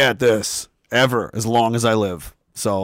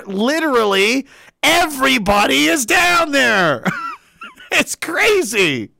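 excited shout; 11 semitones higher, slower than usual. Real, not acted. Telling viewers everyone is downtown, excited shout. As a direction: shout it out in delight.

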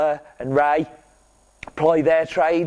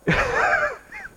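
squeaky burst of laughter; a sudden shrill giggle at his own joke; high-pitched giggle. Real, not acted. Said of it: "should I edit it out".

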